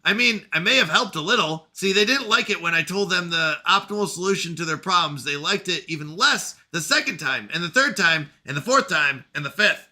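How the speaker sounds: lightly voiced bitter machine intelligence; AI character voice.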